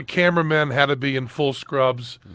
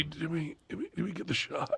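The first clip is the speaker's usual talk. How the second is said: much softer, woozy question; groggy murmur; 17 dB quieter than usual. Retold or imitated. Patient waking from anesthesia asking faintly, groggy. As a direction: ask faintly, just waking. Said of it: "I did come to".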